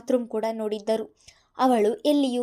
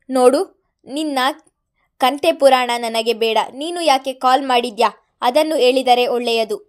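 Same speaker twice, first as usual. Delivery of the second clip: louder, stern and serious; 2 semitones higher, 10 dB louder than usual. A narrator's reading. Mithun demanding why Madan called, stern. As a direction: cut him off, grave. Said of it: "Mithun ಅವರ ಧ್ವನಿ ಗಂಭೀರವಾಗಿತ್ತು".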